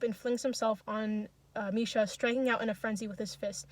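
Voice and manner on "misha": ordinary delivery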